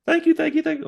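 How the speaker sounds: gushing thanks. Retold or imitated. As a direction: overflow with grateful relief. Housekeeper thanks him again.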